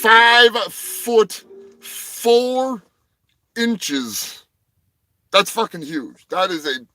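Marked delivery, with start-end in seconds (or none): 0.0-2.8 very slow and louder; drawn-out big reveal; dramatic reveal. Acted. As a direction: stretch each word for effect. Dramatic reveal of afro size.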